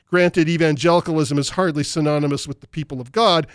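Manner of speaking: ordinary talk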